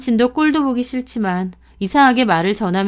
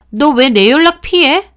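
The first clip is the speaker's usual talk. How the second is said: sharp, raised accusing question; higher, louder reproach; accusing demand; 6 semitones higher, 6 dB louder than usual. Acted. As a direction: confront her for dodging calls. Friend confronts narrator at shop.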